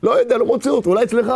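high, calling out to each other; carters calling. Retold or imitated. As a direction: shout across the train while searching. Carters shouting while searching.

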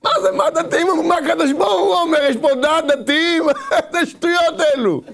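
high-pitched, incredulous ridicule; laughing indignation. Acted. Preacher ridiculing talk of what the religious say, laughing indignation. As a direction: scoff at the question with a laugh breaking in.

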